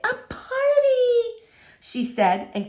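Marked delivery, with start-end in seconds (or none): 0.0-1.1 put-on high, slow, delighted old lady voice. Miss Franny hearing of the party, delighted and slow. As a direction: savor the word like a treat.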